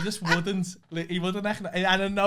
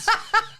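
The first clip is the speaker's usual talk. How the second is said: sudden high burst of laughter; high squealing laugh; big laugh; 6 dB louder than usual. Real, not acted. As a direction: crack up helplessly. Bursts out laughing mid-story.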